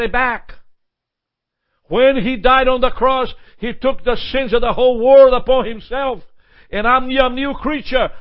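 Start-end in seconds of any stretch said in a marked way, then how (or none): 4.0-8.1 louder, higher, fervent proclamation; preaching crescendo. Acted. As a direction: preach it with rising fire. Preaching at full force.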